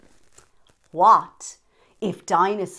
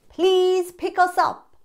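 high bright voice; 10 semitones higher, 5 dB louder than usual. Acted. Note read out.

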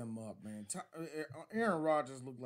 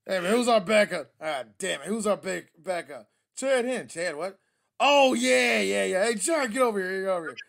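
loud, higher hollering coach; hammed-up coach yell; 4 semitones higher, 13 dB louder than usual. Coach forgets then hollers for Chad, loud mock yell. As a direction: holler across the sideline.

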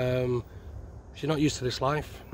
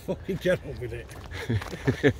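slow words pushed out through a laugh; much slower than usual. Real, not acted. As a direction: laugh at him as you tell him to hurry. Host teasing, laughing.

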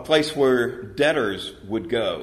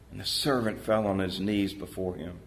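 ordinary talk, nothing marked

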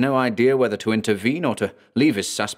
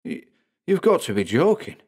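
higher, stammering disbelief; faltering voice; 7 semitones higher than usual. Acted. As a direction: let the voice falter. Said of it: "his voice faltering".

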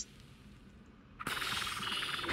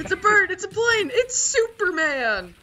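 excited announcer; 8 semitones higher than usual. Pretend. Quoting Superman catchphrase excitedly.

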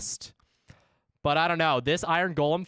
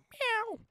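very high mock cat meow, softer; playful meow; 6 dB quieter than usual. Streamer to chat, a tiny high cat meow. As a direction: squeak out a silly kitten meow. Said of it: "all I have to say is just meow".

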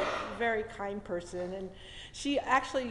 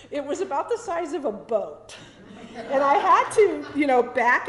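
laughing through the words, a bit louder; 5 semitones higher, 11 dB louder than usual. Real, not acted. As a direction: chuckle as you picture the huge car. Laughing while recalling huge car.

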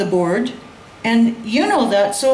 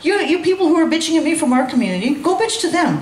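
mock outburst; 7 semitones higher, faster than usual. Retold outburst at the community.

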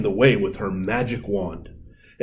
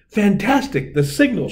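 high, eager burst; bright jump of excitement; excited fairy; 10 semitones higher than usual. A narrator's reading. Tooth Fairy spotting the signal, excited. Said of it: "she said".

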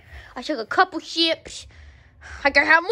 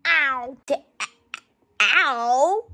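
normal talking voice

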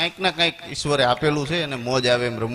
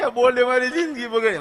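higher mock whine; crying man; 8 semitones higher than usual. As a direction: whine it like the heartbroken groom. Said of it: "રોતો જાય". (બોર્ડને દોષ દેતા રડતા માણસનો ઊંચો રોતલ અવાજ)

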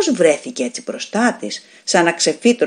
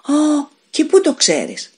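surprised; 10 semitones higher, slower than usual. A narrator's reading. Ruth surprised again.

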